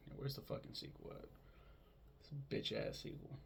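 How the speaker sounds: hushed whisper, half joking; whispered aside. Pretend. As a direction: whisper it like searching a dark room. Mock searching for the sequel.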